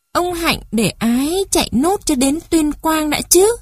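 put-on high-pitched child pleading to keep going; little child's voice. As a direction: play a small child, pitched well up. Child character protests to old man. (giọng trẻ con nài nỉ, cao vút)

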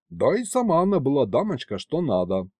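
ordinary delivery

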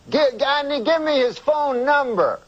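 high, slow Cosby voice; drawn-out high Cosby voice; Bill Cosby impression. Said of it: "I don't even know if I can do Cosby".

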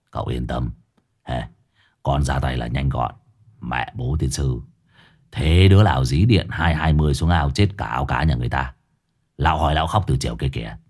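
deep, low mocking voice; a gruff elder poking fun; sneering Hải Nghẹo. A narrator's reading. Hải Nghẹo mocks Long's pond blunder, low and sneering.

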